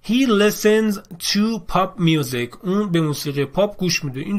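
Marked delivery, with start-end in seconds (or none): 0.1-2.6 each English word spaced out slowly for learners; slow model reading. Shown as a demonstration. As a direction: read it like a teacher's slow example. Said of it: "مثلاً". (جمله انگلیسی را خیلی آهسته و شمرده بخوان)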